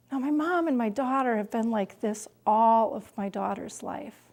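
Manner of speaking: ordinary talk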